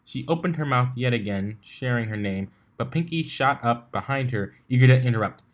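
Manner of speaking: plain reading voice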